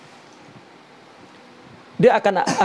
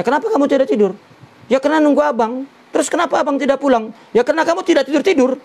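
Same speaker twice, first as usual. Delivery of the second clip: quick, higher back-and-forth of a spat; imitating bickering couple; 7 semitones higher, faster than usual. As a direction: play both sides of a petty marital quarrel. Suami istri bertengkar, tanya jawab. (bertengkar suami istri, cepat dan bernada tinggi)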